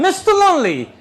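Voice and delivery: high, loud official voice; official greeting. Retold or imitated. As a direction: announce the name grandly. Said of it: "now it's a man".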